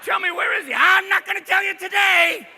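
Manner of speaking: high, loud, unhinged uncle rant; drugged uncle. Acted. Uncle rambling at nephew in mall, high loud and unhinged. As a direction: blurt it like an uncle on the wrong dose.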